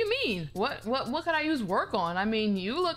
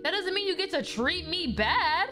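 normal talking voice

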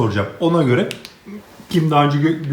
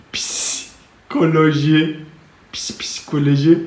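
slow, softer drawn-out repeat; 6 dB quieter, slower than usual. Acted. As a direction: let the word trail out slowly and softly. Punchline repeated softly.

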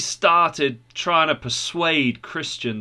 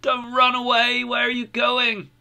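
put-on high child's voice calling out; acted little kids' plea, pitched way up; pleading children; 11 semitones higher than usual. A narrator's reading. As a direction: beg a parent to stay in a high, childlike pitch. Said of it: "his little boy and his girl came out and they said".

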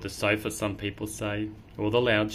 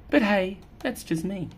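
ordinary delivery

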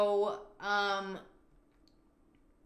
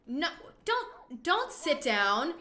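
high, loud, drawn-out objection; playfully outraged at an intruder; exasperated protest; 6 semitones higher, 4 dB louder, much slower than usual. Pretend. Shooing intruder from her house.

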